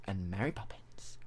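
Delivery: soft unvoiced whisper. Real, not acted. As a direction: whisper it as a jab. Teasing whisper.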